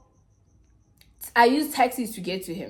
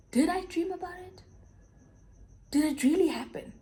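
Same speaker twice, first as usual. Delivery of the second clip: higher-pitched, bewildered self-questioning; disbelief; 8 semitones higher, 4 dB quieter than usual. Retold or imitated. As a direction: re-enact wondering to yourself in disbelief. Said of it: "I was in such disbelief".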